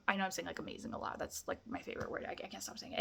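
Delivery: soft whispered aside; sheepish whisper. Real, not acted. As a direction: confess it under your breath. Sheepish aside about word use.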